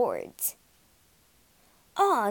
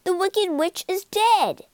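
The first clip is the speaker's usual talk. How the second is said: eager claim; 10 semitones higher, 5 dB louder than usual. Acted. Friends claim their rewards.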